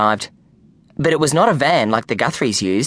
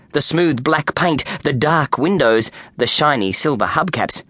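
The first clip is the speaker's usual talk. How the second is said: ordinary delivery, nothing marked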